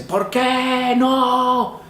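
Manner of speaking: long, high, drawn-out anguished cry; heartrending scream. Retold or imitated. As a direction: wail it out slowly, torn apart. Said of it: "como un grito desgarrador de un hombre".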